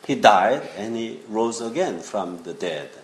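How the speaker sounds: ordinary delivery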